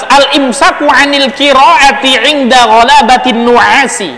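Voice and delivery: measured kitab reading; Arabic text recitation. Acted. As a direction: recite the classical text slowly. Rereads the Arabic heading.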